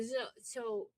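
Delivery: usual speaking voice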